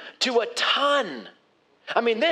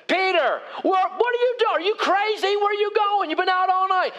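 yelling fishermen; 10 semitones higher than usual. Acted. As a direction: call out across the water, much higher. Onshore fishermen yelling at Peter.

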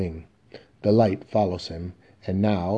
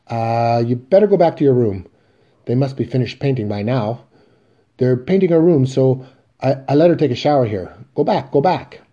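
loud, higher, hurried shooing; frantic hushed-up urgency, louder; panicked urging; 5 semitones higher, 8 dB louder than usual. Acted. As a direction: push her out before the son sees. Willie hurries woman away.

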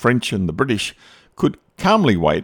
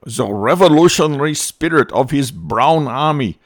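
hyped-up propaganda speech; 5 semitones higher than usual. Retold or imitated. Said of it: "when he said".

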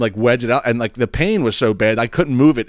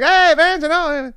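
high, friendly put-on neighbor voice; imitating the neighbor; slower than usual. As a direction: echo his casual greeting in a higher voice.

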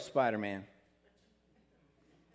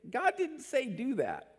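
laughing; 9 semitones higher than usual. Real, not acted. Laughing at Moses.

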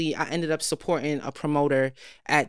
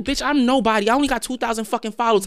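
higher indignant rant at friend; retold exasperated outburst; 5 semitones higher than usual. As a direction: protest to her friend, higher pitch. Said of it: "I'm like".

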